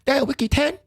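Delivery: high, incredulous quoted voice; movie character quote. Retold or imitated. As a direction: ask it up high in disbelief. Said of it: "And then he say".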